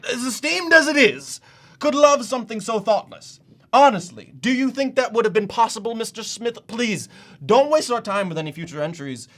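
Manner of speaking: loud, high-pitched angry tirade; indignant outburst. Acted. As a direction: berate the applicant at full volume, outraged. Outburst berating the rejected applicant.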